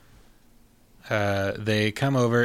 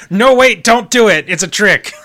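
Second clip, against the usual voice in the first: high, loud urgent mouse voice; Brain warning; 10 semitones higher, 12 dB louder than usual. Acted. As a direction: warn them off as the clever mouse. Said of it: "And Brain's just like".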